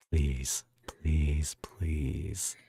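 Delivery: drawn-out, low begging; long, imploring pleas; pleading. Real, not acted. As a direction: beg with each please stretched out. Begs to hear the accent.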